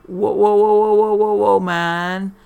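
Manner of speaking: loud, high dazed whoa; hollering whoa from the carpet, stoned out of his mind. Acted. Stoned father falls off chair.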